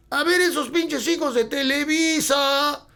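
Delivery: high, drawn-out mock yell from a crowd; imitated heckler's shout. Crowd member jeering at the band as Televisa kids, shouted. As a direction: heckle the band in a stretched, high-pitched holler. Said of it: "lo primero que me acuerdo que nos gritaron".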